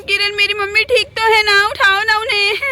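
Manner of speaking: ordinary talk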